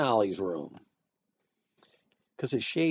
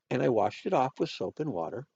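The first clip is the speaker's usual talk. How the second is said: ordinary delivery, nothing marked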